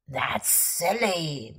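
low, soft, slow sleepy mumble. A narrator's reading. Sleepy friend mumbling to scared little bear. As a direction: mumble it, half asleep. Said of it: "mumbled Kermit sleepily".

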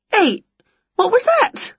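high startled question; startled witch. A narrator's reading. Winnie feeling her mouth, high startled. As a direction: caught off guard.

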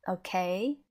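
"okay" as a question; questioning rise. Shown as a demonstration. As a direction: ask "okay?" with a rising glide. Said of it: "like when you say right or".

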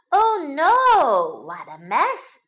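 loud, high cry of dismay; mother's hammed-up alarm. A narrator's reading. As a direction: exclaim at a terrible mess. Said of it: "cried Missus Rabbit".

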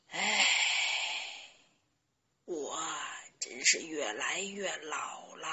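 put-on low, sighing old grandfather; lamenting. A narrator's reading. Grandpa alone under the moon, sorrowful lament. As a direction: sigh heavily, then bemoan it low and wearily. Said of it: "他悲叹道".

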